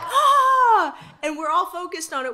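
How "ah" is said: high loud laughing shriek. Retold or imitated. Band reacting as the bug is stomped, laughing shriek. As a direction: let out a squeal of disgust. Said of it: "And we're like".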